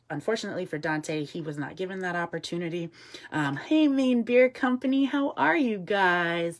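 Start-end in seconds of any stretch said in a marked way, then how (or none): 3.6-6.6 high, loud hello, last words drawn out; excited greeting. Real, not acted. Host greets a company watching the stream, excited. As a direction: sing out a big hello to friends.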